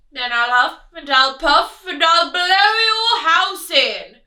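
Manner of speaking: loud menacing wolf voice; wolf threat. Acted. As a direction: threaten to huff and puff.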